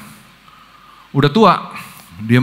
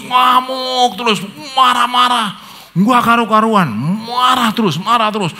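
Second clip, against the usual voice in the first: raging; 9 semitones higher than usual. Retold or imitated. Raging uncle in hospital.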